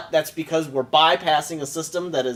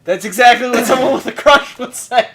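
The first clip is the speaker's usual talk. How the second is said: teasing; 8 semitones higher, 10 dB louder than usual. Real, not acted. Teasing friend, high far voice.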